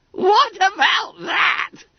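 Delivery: very high, loud, drawn-out squeal; triumphant trill. A narrator's reading. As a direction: trill it slowly, squeaky with glee. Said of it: "trilled Bunter".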